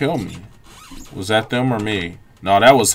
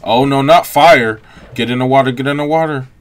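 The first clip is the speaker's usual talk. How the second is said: louder and higher, urgent; panicked shout; 4 semitones higher, 9 dB louder than usual. Real, not acted. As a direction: yell in real alarm at the fire. Fire breaking out, panic.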